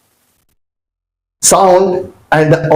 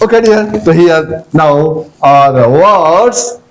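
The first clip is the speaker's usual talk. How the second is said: normal talking voice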